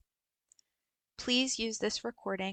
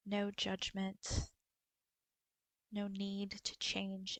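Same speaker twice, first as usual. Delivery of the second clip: guided meditation. Acted. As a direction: guide them gently through a body scan, unhurried. Leads a body scan meditation.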